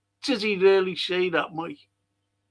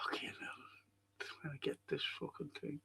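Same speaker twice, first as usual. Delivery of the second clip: whispered curse; 5 semitones lower, 21 dB quieter than usual. Real, not acted. Cursing to himself off-mic.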